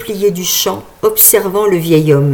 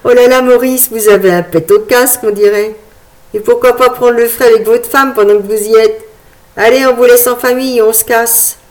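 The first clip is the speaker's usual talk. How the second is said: mocking boy; 3 semitones higher, 4 dB louder than usual. Boy mocks old man.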